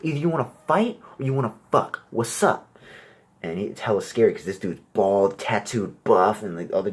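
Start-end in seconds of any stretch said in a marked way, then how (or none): 0.0-2.8 higher, louder tough guy; impatient bro. Retold or imitated. Tough guy losing patience with the talker, higher and louder. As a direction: push him, bored of the talking. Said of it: "he's like".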